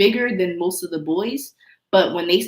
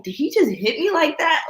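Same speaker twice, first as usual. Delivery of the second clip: shocked disbelief; 10 semitones higher, 4 dB louder than usual. Retold or imitated. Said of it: "and I was like".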